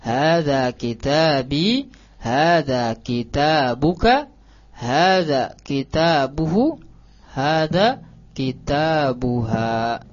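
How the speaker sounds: slow, deliberate recitation of each Arabic phrase; careful model reading. Shown as a demonstration. Teacher modelling the Arabic phrases for the students, slow and careful. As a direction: read it slowly so the class can copy the intonation. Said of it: "intonasinya diperhatikan".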